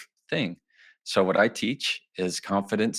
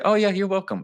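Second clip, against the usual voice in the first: much higher, playful voice of the recipient; mock reply; 11 semitones higher, 4 dB louder than usual. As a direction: play the flattered recipient, high. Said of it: "they're gonna say".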